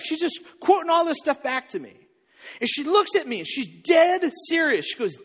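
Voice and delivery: ordinary talk, nothing marked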